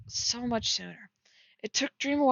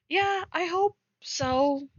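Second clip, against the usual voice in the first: high, slow mock answer; mock reply; 8 semitones higher, slower than usual. Writer mocks Dream's vague reply, high and slow. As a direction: drawl it out offhandedly. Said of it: "kind of like a".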